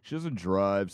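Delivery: ordinary talk, nothing marked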